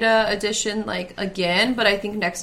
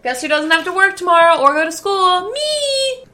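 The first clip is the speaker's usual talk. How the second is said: loud, high, gleeful cheer; excited shout; 10 semitones higher, 8 dB louder than usual. Real, not acted. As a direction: squeal the last word with joy. Celebrating no work tomorrow.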